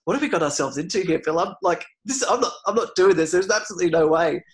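alarmed refusal. Retold or imitated. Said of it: "I said to Phil".

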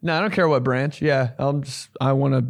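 ordinary talk

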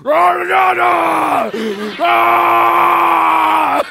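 loud, drawn-out monster bellow; hammed-up monster holler; monster roar. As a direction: roar it out long and high like an echoing monster. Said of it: "so I sounded like a monster".

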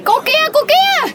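high, drawn-out call to stop; calling out sharply. Acted. Bystander calls out to the woman who started hitting, high drawn-out call. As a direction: call her out, high and stretched.

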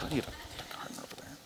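breathy whisper; whispered aside. Retold or imitated. Preacher teasing Mary's mistake in a whisper. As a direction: whisper it like a sly jab.

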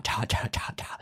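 normal talking voice

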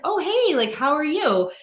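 high, loud, overly cheery hello; bright mock greeting. Friend greeting an influencer, high cheery hello.

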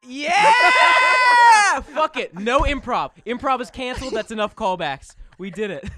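laughing shout. Real, not acted. Shouting, laughing, cancelling improv.

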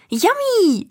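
high, loud squeal of delight; excited cry. A narrator's reading. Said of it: "Riep Noor". (opgetogen uitroep)

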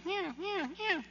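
high, soft mewing meows; cat meows. Retold or imitated. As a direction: mimic a trapped kitten's thin mew. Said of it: "sure enough hear this meow".

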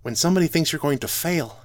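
a hint of a hurt dwarf bard; the dwarf bard Drogon, lightly voiced and hurt; Drogon speaking. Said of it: "looked up at me from his character sheet and said".

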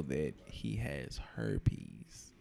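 hushed whisper. Real, not acted. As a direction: whisper it like a secret. Unvoiced whisper.

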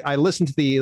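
ordinary delivery

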